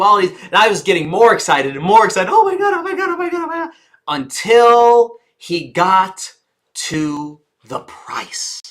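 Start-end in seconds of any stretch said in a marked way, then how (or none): 2.3-3.7 much higher squealed oh my God; giddy high-pitched squeal; squealing excitement. Retold or imitated. Retold rising excitement, squealing. 4.1-8.6 very slow build, price nearly breathed; slow suspense reveal; slowed pace. Shown as a demonstration. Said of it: "Notice my pace".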